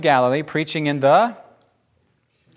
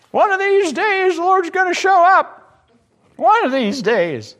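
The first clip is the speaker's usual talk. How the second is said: mock wistful sigh; 4 dB louder than usual. Mock wistful churchgoer, far.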